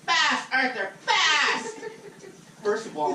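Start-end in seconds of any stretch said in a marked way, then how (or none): 0.0-1.8 high, loud barking order; squeaky boss yelling orders. Retold or imitated. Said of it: "Did you suck the fucking helium".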